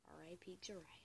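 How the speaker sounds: soft whispered R I P Jiraiya. Shown as a demonstration. Mourning the manga character Jiraiya, whispered. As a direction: breathe it out as a hushed tribute. Repeats phrase, second whispered.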